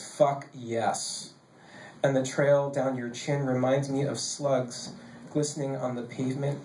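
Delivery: rolling, cadenced poetry recital; poem reading. Acted. Poet reading an explicit poem to a laughing audience, steady recital. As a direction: let the long lines run on in a poet's cadence.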